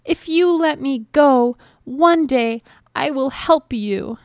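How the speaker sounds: high, pleading little mouse voice; high-pitched earnest plea; begging mouse. A narrator's reading. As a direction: bargain pleadingly as a tiny mouse. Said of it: "begged the mouse".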